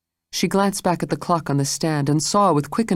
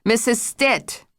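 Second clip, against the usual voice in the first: slow, higher sharp call; 8 semitones higher, slower than usual. A narrator's reading. Jane calls the maid sharply from the door. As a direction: call her out from the doorway. Jane's angry arrival.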